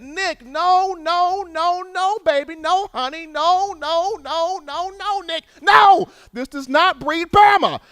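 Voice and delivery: everybody's mama begging Nick, high and pleading; pleading naysayers. Retold or imitated. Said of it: "everybody and their mama said".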